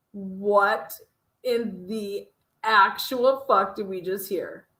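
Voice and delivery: ordinary talk, nothing marked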